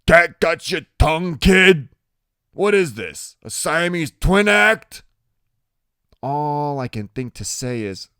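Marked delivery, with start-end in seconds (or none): 0.1-5.0 loud, raised, needling voice; big biker jeering at a kid; taunting biker. A narrator's reading. Tattoo dude poking the kid in the chest, loud taunting.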